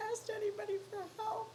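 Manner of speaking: fighting back tears as she asks; tearful. Real, not acted. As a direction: voice cracking into tears, a bit quick. Pleading for help, near tears.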